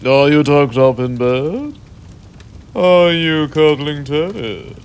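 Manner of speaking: usual speaking voice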